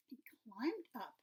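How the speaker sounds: plain reading voice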